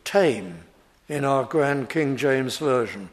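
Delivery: ordinary delivery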